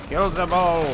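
megalomaniac rant. Pretend. Battle cry at enemies.

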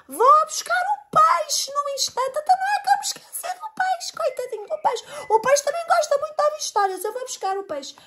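very high squeaky voice; high fast squeaky rodent; hamster voice. A narrator's reading. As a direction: chatter high like a tiny hamster.

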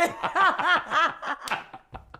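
long peal of laughter; laughing. Acted. Woman recalling the joke about Σμαράγδα, laughing.

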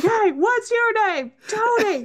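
high, loud, hammed-up yelling at a stranger; mock angry shout. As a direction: yell it like a street brawler. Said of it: "very angry words being shouted".

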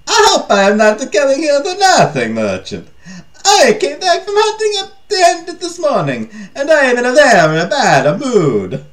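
high, loud cartoon Italian king; exaggerated mock-Italian, loud and high-pitched; over-the-top Italian accent. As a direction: ham up a bad mood in a broad, singsong Italian accent. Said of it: "it'd be a bit much".

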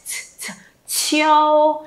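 isolated puffs "ch, ch", then long "chiêu"; aspirated ch. Shown as a demonstration. Teacher has class listen to the sound, "ch" strongly aspirated. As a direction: let the air burst on each "ch" before sustaining "chiêu". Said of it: "phải nghe thì cái âm ch".

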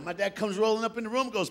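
ordinary talk

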